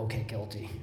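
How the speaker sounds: very low, soft admission; meek surrender. Retold or imitated. Defendant accepting the prosecutor's thirty dollar deal, meekly and quietly. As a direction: give in almost under your breath.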